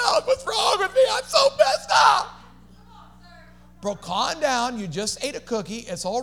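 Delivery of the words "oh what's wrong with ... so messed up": mock despairing cry, high and loud; hammed-up wail. Pastor mocking a faster who broke the fast, wailing. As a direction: overplay the self-pity.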